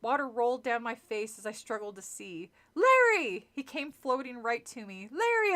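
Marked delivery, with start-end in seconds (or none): 2.7-3.5 high, loud call; calling his name. Acted. Calling the floating counselor. 5.1-5.5 high, loud scream of a name. Acted. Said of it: "I managed to scream".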